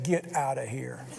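soft, slow, laughing get out of here; scoffing in amused disbelief; a light laugh through it. Retold or imitated. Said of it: "He said".

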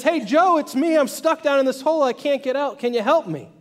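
high, loud acted call for help; shouting up. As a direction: hail a friend from down below, urgently. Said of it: "he shouts up".

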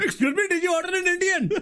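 high-pitched hammed-up Indian accent. Helping out the storyteller as the driver, exaggerated Indian accent. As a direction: go full caricature Indian accent. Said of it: "one of these accents, like, head wobble".

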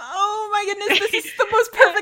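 high, delighted squeal through laughter; excited fan reaction. Retold or imitated. Fan reliving the moment Joe jumps out, thrilled and high. Reenacts viewer's surprised reaction.

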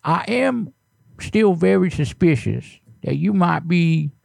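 put-on creaky old neighbor, fussy and nosy; old man voice. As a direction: play a suspicious grumpy grandpa. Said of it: "anytime I use that old voice".

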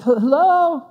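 high, drawn-out questioning hello; tentative calling. Retold or imitated. Calling for a clerk in an empty store, tentative. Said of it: "you appear, like, way too passive".

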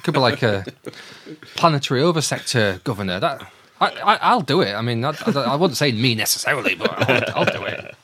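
comic skit of an eager job-seeker; hammed-up pitching underling. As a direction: play an underling floating an idea, voice pushed up. Host acts out Tarkin pitching to Vader.

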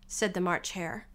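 ordinary delivery, nothing marked

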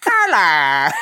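loud, drawn-out Australian hello; exaggerated Australian accent. [name] greets a visitor, loud hammed-up Australian accent. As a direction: swoop through a cartoon Australian greeting. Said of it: "Is this Australian, this accent you're doing".

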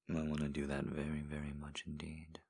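soft, close whispered encouragement; intimate ASMR whisper. Acted. Voice in a lover's ear, guiding in whisper. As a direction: coax them on in a hushed whisper. Erotic audio whispered guidance.

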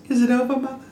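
put-on high, small child's voice; high-pitched little kid; little Robert. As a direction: put on a little boy asking his mother. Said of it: "Little Robert asks".

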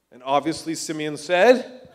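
ordinary talk, nothing marked

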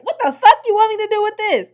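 high, loud shocked yell; a high, loud shriek at the date who hands her a gun; outraged yell. Retold or imitated. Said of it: "So I'm like".

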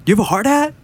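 high-pitched rising question; mock call with a rising end; retold questioning call. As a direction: ask it high, as if to someone distant. Retold question to distant worker.

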